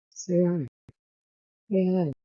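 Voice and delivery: high coaxing pet voice. Real, not acted. Coaxing the pet bird to talk.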